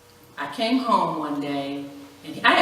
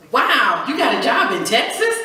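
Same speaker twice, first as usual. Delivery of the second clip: surprised; 6 semitones higher, 7 dB louder than usual. Retold or imitated. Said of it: "I was like".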